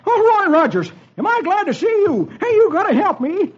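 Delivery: put-on very high, agitated old-timer character; frantic Dolfo. Running man begging Roy for help, frantic high voice. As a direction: plead in a high, excited cartoon voice.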